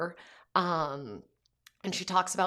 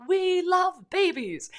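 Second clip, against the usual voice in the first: put-on much higher, slow, drawn-out little-kid voice; toddler son; 14 semitones higher, 5 dB louder, much slower than usual. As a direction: echo it like a tiny boy copying his parents. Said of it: "He's like".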